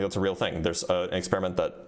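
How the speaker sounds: ordinary talk, nothing marked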